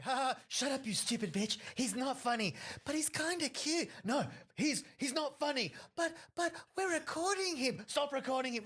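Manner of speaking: mocking the girl who recorded the set, high squeaky mock voice; mock bickering girl. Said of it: "trying to convince her, like".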